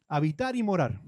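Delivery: usual speaking voice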